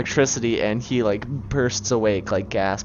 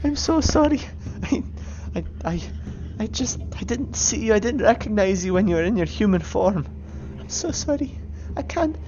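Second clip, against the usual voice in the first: high, frightened Irish pleading; Irish accent. Acted. Creature begging not to be struck by lightning, Irish accent. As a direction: cower and beg in a high Irish voice. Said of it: "He's Irish. I don't know why is he Irish".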